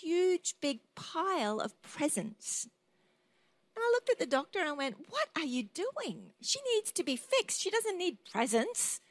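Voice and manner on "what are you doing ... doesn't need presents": high-pitched, incredulous objection; mock indignant protest. Mother objecting to the doctor in a dream, high indignant protest. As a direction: protest in a much higher voice, acting it out.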